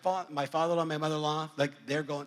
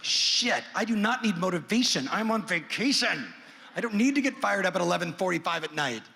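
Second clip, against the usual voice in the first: raised, hammered-out comic outburst; exasperated protest; 4 semitones higher, 4 dB louder than usual. Retold or imitated. Dad on vacation rejecting late-night motivation, exasperated. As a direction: protest loudly in mock outrage, punching each phrase.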